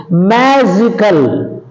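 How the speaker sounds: magical said slowly with MA stressed and drawn out; stress on first syllable of magical. Shown as a demonstration. Teacher demonstrating word stress, magical slow with MA stressed. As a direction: lean on the MA in magical, stretching the word. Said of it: "on the anti-penultimate syllable".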